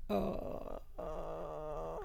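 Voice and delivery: mock dumbfounded uh, high and soft; baffled uh. Friend retelling her stunned reaction, mock dumbfounded. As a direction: play it speechless and lost. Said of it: "And I was just like".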